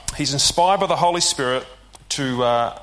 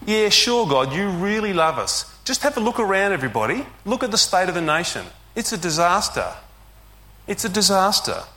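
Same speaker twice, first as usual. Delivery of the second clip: higher, mocking sarcasm; sarcastic complaint; 4 semitones higher than usual. Israel sneering at God's love, sarcastic. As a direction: sneer at the claim. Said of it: "It's a bit like this".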